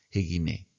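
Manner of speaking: jeguiné softly as a single word, h sound at the start; teacher repeats the joined form softly; one joined word. Shown as a demonstration. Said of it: "como si fuera una sola palabra".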